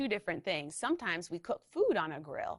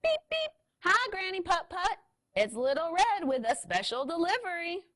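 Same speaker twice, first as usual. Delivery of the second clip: very high, bright call; cheery little scooter; 11 semitones higher, 5 dB louder than usual. Acted. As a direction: chirp it up high like a tiny scooter. Little Red calls cheerfully.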